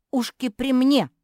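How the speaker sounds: loud, high defiant retort; defiant shout. A narrator's reading. Sister defends her ears in a squabble, shouting defiantly. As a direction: shout it back at a rival.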